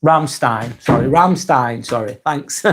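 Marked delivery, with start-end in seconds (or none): none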